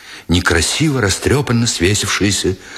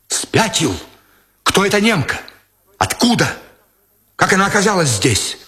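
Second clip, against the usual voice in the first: higher, urgent questioning voice; agitated young lieutenant; 9 semitones higher than usual. A narrator's reading. Lieutenant demanding who the German woman is, high and urgent. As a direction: fire questions at him, alarmed.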